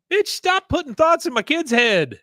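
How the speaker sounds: outraged outburst. Real, not acted. Host scolding Peggy for telling son to ride bus, indignant yell. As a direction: snap at her like she's in the room.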